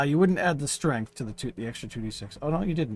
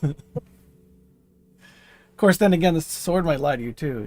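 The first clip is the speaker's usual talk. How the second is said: ordinary delivery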